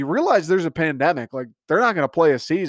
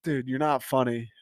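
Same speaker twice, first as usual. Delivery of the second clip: deadpan put-down; 2 semitones lower, 7 dB quieter than usual. Real, not acted.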